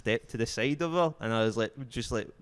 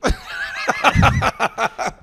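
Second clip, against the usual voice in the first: loud high laugh; 11 dB louder than usual. Real, not acted. Teller cracks up at his own cash machine line, loud laugh.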